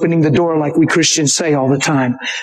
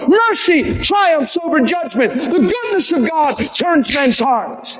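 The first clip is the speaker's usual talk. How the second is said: high, driving, shouted preaching; full-force preaching; 11 semitones higher than usual. Real, not acted. Preacher to congregation on Jesus bringing life, full-force preaching. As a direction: preach it high and hard, drawing out the last phrases.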